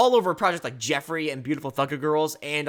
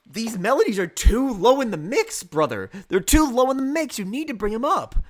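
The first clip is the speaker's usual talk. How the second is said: high, emphatic complaint, louder on key words; exasperated plea; 8 semitones higher, 5 dB louder than usual. Real, not acted. Reviewer urging the producer to fix his mix, exasperated. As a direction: plead insistently in a raised, swooping pitch.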